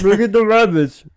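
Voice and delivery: hammed-up Lenny. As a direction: say it like an excited childlike giant. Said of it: "Lenny off of Mice and Men".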